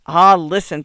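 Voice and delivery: loud, pleading groan; mildly exasperated plea. A narrator's reading. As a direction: groan out an appeal for patience. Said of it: "Parker groaned".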